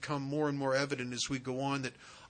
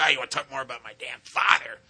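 retold angry snap; 7 dB louder, faster than usual. Angry sponsee retold in a quarrel.

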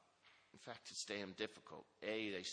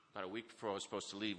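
normal talking voice

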